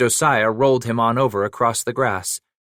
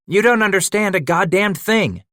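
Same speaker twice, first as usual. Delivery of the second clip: sharp, loud, much higher snap; snapped; 10 semitones higher, 4 dB louder than usual. Acted. Josiah cutting off his old partner, angry snap. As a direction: bite off the words in anger. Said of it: "Josiah snapped".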